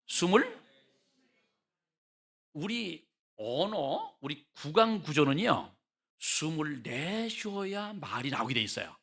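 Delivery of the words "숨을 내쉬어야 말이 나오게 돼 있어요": ordinary delivery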